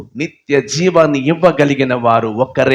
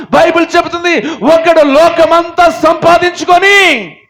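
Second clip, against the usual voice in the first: high, loud sermon at full force; fiery preaching; 14 semitones higher, 6 dB louder than usual. Real, not acted. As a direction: thunder it at the congregation. (ఉద్రేకంగా గట్టిగా ప్రసంగం)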